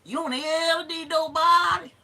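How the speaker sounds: high, loud Southern grandmother impression; grandmama. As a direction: holler it like your grandmama. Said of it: "say it like my grandmama used to".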